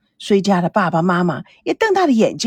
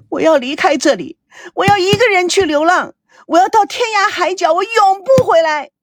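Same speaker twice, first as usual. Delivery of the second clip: hysterical vow; 8 semitones higher than usual. A narrator's reading. Daughter beating the bed and vowing to leave, hysterical. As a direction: pitch climbing into a high cry. 女儿打着床哭喊要离开.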